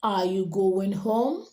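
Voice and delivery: slow question, sweeping up on "home"; rising intonation. Shown as a demonstration. Teacher models asking a question, voice rising on "home". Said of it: "So it's going up".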